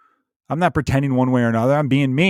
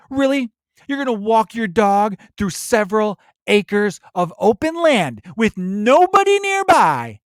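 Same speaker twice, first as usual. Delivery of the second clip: much higher, slow incredulous put-on voice; far-left guy mocking a dog walker, higher and slower; mock scold; 9 semitones higher, slower than usual. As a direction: stretch out the disbelief. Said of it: "did a stellar impression of".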